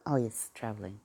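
ordinary delivery

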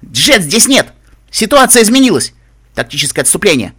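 loud, high-pitched alarm; urgent commander's yell; shouting. A narrator's reading. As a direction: shout the retreat order to your squad. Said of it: "кобыла с огненно-ржавой гривой закричала".

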